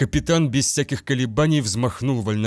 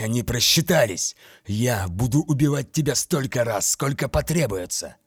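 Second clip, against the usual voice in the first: defiant vow. A narrator's reading. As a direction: promise death through the teeth. Garo vows to kill the monster.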